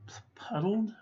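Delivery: whispered "puddled" with a questioning rise; doubting whisper; unsure whisper. Real, not acted. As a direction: hesitate and whisper "puddled?" to yourself.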